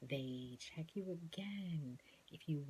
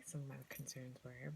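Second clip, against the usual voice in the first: ordinary talk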